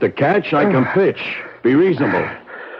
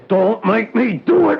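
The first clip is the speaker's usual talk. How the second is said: desperate plea; 10 semitones higher than usual. Acted. Pleading before threatening to kill.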